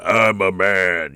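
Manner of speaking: low, slow, hammed-up he-man; over-the-top male voice. Shown as a demonstration. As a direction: go ridiculously deep and drawn out as a manly man. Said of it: "do that over the top".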